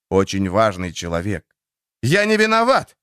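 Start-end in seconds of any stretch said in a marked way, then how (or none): 2.0-3.0 high loud pleading cry; desperate cry. A narrator's reading. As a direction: cry out your innocence. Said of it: "хотелось крикнуть ему".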